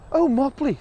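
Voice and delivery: high fond bird voice. Acted. Bird thanks the mouse, high and fond. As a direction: sigh his name fondly, pitched high.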